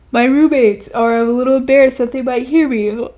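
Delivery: talking through a yawn. Real, not acted. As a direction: yawn mid-sentence, words stretched. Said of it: "I'm yawning".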